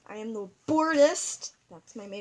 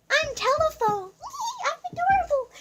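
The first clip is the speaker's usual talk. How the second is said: cutesy squeaky voice; 7 dB louder than usual. Pretend. Squeaky dragon character voice.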